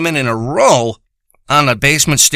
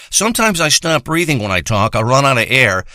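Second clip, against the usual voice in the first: ordinary talk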